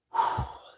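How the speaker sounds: loud tired sigh out; heavy sigh. Real, not acted. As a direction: let out a heavy breath. Worked up, catching breath.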